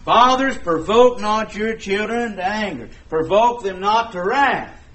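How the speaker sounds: booming, slow scripture proclamation to the flock; preaching at full force. Acted. Preacher warning fathers in the congregation, booming and slow.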